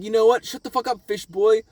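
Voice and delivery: snapping villain. Retold or imitated. Shooter silencing the fish boy, mock irritated outburst. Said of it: "then the guy's like".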